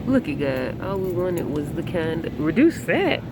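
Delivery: usual speaking voice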